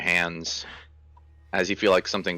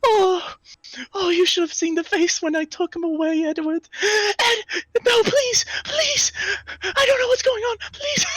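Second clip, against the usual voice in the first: very high crying plea, breaking; pleading sobs; 4 dB louder than usual. Acted. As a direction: beg through tears in a high voice. GM voices crying creature pleading.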